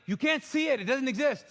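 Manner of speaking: very high, loud indignant retort; mock shout. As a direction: squeal it high in protest. Arguing with wife.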